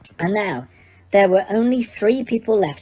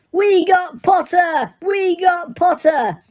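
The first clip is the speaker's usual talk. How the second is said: higher, slow triumphant chant; yelled; 8 semitones higher, slower than usual. A narrator's reading. Weasley twins cheering Harry to their table, slow triumphant yell. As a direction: crow it across the table. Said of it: "the Weasley twins yelled".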